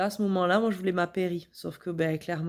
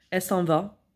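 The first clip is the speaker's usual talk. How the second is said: ordinary talk, nothing marked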